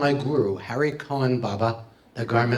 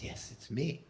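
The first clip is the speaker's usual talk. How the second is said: very soft, mild spirit voice; faint voice; 17 dB quieter than usual. Acted. Departed friend answers the meditating seeker, faint voice. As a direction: say it faintly, much softer. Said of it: "he hears this faint yet familiar voice".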